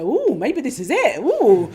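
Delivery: a rising gasp of hope, then an excited ooh; excited inner voice. Retold or imitated. Meditator's inner voice getting excited, eager and loud. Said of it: "and then the voice go".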